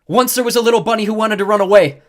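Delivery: loud, high megaphone yell. A narrator's reading. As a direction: yell through cupped hands. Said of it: "and yelled".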